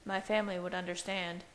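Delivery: usual speaking voice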